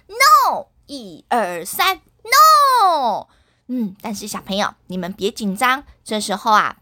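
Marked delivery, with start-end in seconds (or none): 0.1-3.2 slow, drawn-out loud NO; call-and-response shout; group shout. Shown as a demonstration. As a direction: lead the kids in a big no. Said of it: "我们一起跟阿龙还有大宝说".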